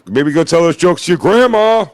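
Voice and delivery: high-pitched acted taunt; mock heckle. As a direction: jeer it as a player sending him off.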